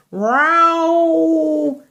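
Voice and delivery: drawn-out yowling cat; a cat wailing from a branch. Acted. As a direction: yowl it like a stuck cat. Cat yowl sound in story.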